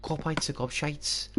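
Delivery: slow, badly faked Scouse; attempted Scouse accent. Still trying the Liverpool dude, struggling Scouse accent. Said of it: "I really can't do it".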